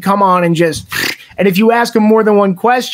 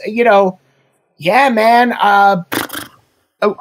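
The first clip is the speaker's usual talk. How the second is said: ordinary talk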